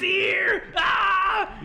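high, loud falsetto shriek of discovery; mock horrified scream. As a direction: act out a victim screaming in horror. Acting out victim's horrified discovery.

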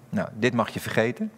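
ordinary talk, nothing marked

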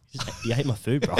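laughing through a mock complaint to a mate; half-laughing, half-teasing the words out; laughing quote. Mate eating his food, retold.

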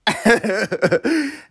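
high-pitched laugh; amused laugh. Real, not acted. Teller recalls bully's head hitting locker, amused laugh. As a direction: laugh at the memory.

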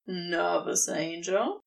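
a demon toying with his angel; teasing serpent. A narrator's reading. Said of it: "Crowley asks".

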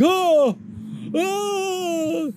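high drowsy groan; high sleepy moan. Acted. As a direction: moan like a dozing villain. Sleepy Joker on live feed.

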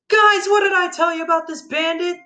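high-pitched, strained tearful cry; tearful outburst. A narrator's reading. Child to parents about the bandit, tearful high wail. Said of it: "spoke with tears streaming down my face".

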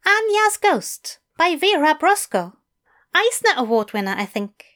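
high, eager English teacher; bright, keen invitation from a fussy gentleman. A narrator's reading. Fell voice.

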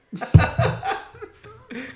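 high burst of laughter. Real, not acted. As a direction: laugh out loud. Spilled beer, laughing.

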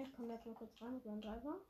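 normal talking voice

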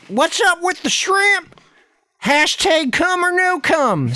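high, slow teasing message; playful high reading. Real, not acted. Typing message aloud, high and slow.

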